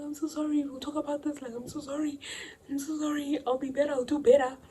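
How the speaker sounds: high, soft tearful pleading; tearful apology. Retold or imitated. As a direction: beg through tears. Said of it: "So I'm like".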